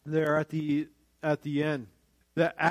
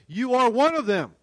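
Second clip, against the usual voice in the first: raised, louder, insistent accusation; adamant; 6 semitones higher, 5 dB louder than usual. Acted. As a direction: accuse them firmly, pitched high.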